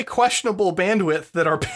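ordinary talk, nothing marked

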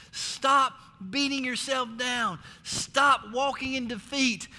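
slow, weighty commands, STOP struck hard; slow exhortation. Real, not acted. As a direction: hammer each STOP home slowly. Exhorting the congregation.

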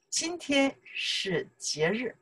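slow, soft, careful Mandarin; slow model sentence. Shown as a demonstration. Teacher modelling a sentence for learners, slow and careful. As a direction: model the sentence slowly and softly. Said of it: "now we can say".

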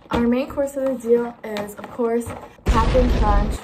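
loud, showy reveal of the cereal; mock grand announcement. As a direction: present it like a fancy dish. Mock fancy breakfast presentation.

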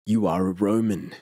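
a lightly voiced rough, wary stranger; a hint of harshness, questioning the traveller; harsh stranger. A narrator's reading. The stranger challenges Paulinus in a lightly harsh voice. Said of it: "said a few words in a harsh voice".

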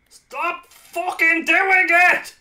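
slow, high, loud furious yell. Real, not acted. Gamer raging at his own fat fingers, furious yell. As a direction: bellow it out at the game in real rage.

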